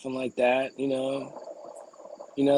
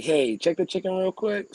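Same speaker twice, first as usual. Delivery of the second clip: higher, slower request; 7 semitones higher than usual. Retold or imitated. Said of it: "I'm like".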